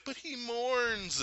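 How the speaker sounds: high, slow, drawn-out imitation; Mantis. Holding Thanos in a trance, high wondering voice. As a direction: draw it out high like an entranced empath.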